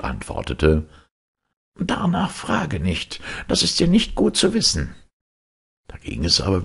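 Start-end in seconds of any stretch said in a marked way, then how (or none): 1.8-4.9 kindly Death, voiced much higher; Death's voice. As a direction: speak as gentle Death, pitched up. Death refuses to answer.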